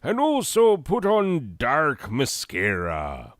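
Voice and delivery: put-on swaying pirate-film accent; put-on pirate accent, lilting advice; Johnny Depp. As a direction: slide the pitch about in a comic pirate accent. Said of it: "how Johnny Depp talks in Pirates".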